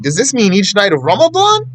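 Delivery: high-pitched mock query, climbing steeply at the end; naive questioner. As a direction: imitate someone asking a needless question. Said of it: "he's gonna come to you and say".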